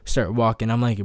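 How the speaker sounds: ordinary talk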